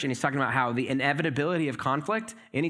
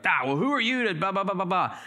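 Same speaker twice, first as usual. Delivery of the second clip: higher, half-hearted indignant huff; mock defensive retort; 5 semitones higher than usual. Preacher mimics someone called out at work, mock indignant. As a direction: brush off the accuser, lightly mocking. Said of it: "You're like".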